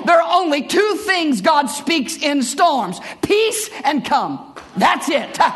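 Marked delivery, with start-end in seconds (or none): none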